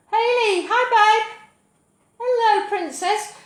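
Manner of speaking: high, loud gushing hello; thrilled to see viewers online; excited greeting. Real, not acted. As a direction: gush a big hello to friends.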